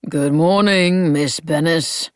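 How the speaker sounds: slow, dragging, can't-be-bothered greeting; bored drawl. A narrator's reading. Old shopkeeper greeting his new assistant in a bored drawl. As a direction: stretch it out, not even looking up. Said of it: "he said in a bored drawl".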